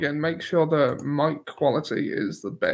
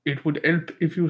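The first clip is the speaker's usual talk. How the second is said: hesitant, badly faked French accent; attempted French accent. Shown as a demonstration. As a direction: try a French accent, dropping the H in "help". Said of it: "I can't do it. It sounds vaguely racist".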